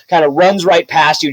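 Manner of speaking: ordinary talk, nothing marked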